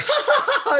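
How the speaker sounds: very high, loud fake ha-ha-ha; hammed-up sister laughing at her sister; mock burst of laughter. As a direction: cackle out in someone's face. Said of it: "And Helen's like".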